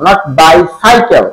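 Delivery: ordinary talk, nothing marked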